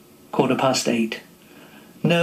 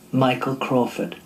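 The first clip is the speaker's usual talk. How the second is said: usual speaking voice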